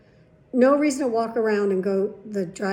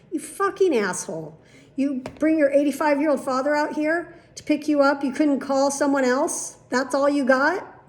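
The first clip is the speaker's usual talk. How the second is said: imagined indignant rant; 4 semitones higher, 4 dB louder than usual. Retold or imitated. Patient silently berating the daughter for bringing her father, indignant. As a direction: build from a curse to incredulous questions.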